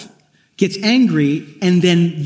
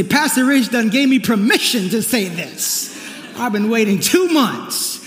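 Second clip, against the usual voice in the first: eager congregant; 5 semitones higher than usual. Pretend. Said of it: "So before you say".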